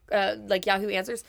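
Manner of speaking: usual speaking voice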